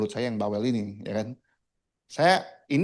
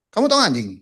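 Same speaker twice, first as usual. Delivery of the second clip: raised, high voice of an angry boss; loud, higher-pitched retold challenge; 9 semitones higher, 7 dB louder, faster than usual. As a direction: imitate a boss firing a hostile question. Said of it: "dia marah besar".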